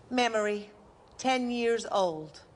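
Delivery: slow, measured title read; stage heading, slow and even; announcer heading. Acted. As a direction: announce the memory slowly. Memory heading.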